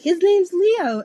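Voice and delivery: slow, high and loud eager. Retold or imitated. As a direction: announce the name eagerly. Friend retelling eager line.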